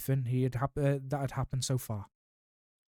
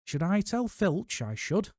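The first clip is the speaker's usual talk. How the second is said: higher, slow falsely pious poltergeist; saintly voice; 7 semitones higher, slower than usual. A narrator's reading. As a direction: sound holy while plotting mischief. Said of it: "in a saintly voice".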